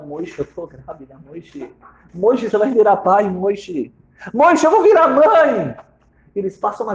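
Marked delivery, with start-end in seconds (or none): 0.0-1.7 hushed joy. Acted. Wife breaks pregnancy news. 2.1-5.7 building from hushed to a high, loud cry of joy; joyful outburst. Acted. Wife telling husband she'll be a mother, rising to joyful cry.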